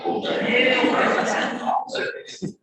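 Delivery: high, loud retold amazement; impressed surprise. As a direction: echo it back, mock-astounded and impressed. His impressed reaction to her answer.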